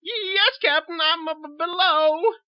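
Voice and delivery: very high, tearful plea; pleading. Acted. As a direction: beg him to understand. Cowed sister.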